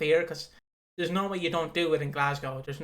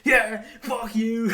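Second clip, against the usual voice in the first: much higher and louder mock rage shouting; yelling at the TV; 7 semitones higher, 6 dB louder, much slower than usual. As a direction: scream abuse at the wrestler on screen. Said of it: "and I'm like".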